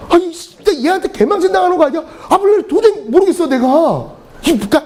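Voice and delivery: high-pitched flustered panic, rushing; a teacher's hammed-up alarm at an unknown word; mock panic. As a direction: sound caught out, panicking in front of a student.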